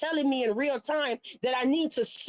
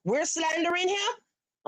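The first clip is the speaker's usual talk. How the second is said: incredulous; 5 semitones higher, slower than usual. Real, not acted. Mocking the accusation on screen.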